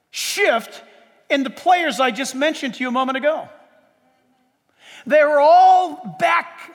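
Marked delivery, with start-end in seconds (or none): 5.1-6.7 slow, loud shout; preacher booming to his church; shouted emphasis. Real, not acted. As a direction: hammer it out slowly at full volume. Preacher stressing a point.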